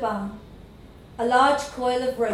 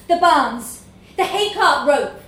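raised voice, louder and higher; shouted; 4 semitones higher, 7 dB louder than usual. A narrator's reading. Tallest boy sends Mishu down the hill, shouted. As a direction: shout it after someone running off. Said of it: "he shouted after him".

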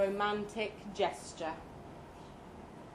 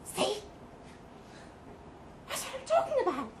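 agitated; 9 semitones higher than usual. Acted. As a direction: blurt it out, worked up. Anxious rant about the cat.

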